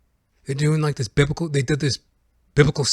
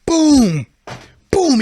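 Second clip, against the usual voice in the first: two loud, high booms; explosive crash imitation; 13 semitones higher, 9 dB louder than usual. Neighbor breaks in the front door, boomed out loudly. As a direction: boom it out like a door smashed in. Door being broken in, acted out.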